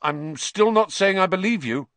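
loud, high-pitched retort; defiant retort. A narrator's reading. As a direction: snap back, refusing to be won over. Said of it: "Harry retorted".

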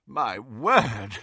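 lightly puffed exclamation; puffing. A narrator's reading. Slughorn, pale and sweaty, reaching the door, puffing. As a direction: puff it out, flustered. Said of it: "he puffed".

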